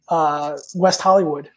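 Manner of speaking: normal talking voice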